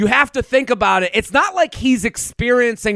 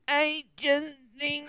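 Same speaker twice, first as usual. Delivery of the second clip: soft, slow, higher whiny imitation; whining; 6 semitones higher, 11 dB quieter, slower than usual. Imitating a meek contestant, soft slow whine. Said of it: "Whining the whole time".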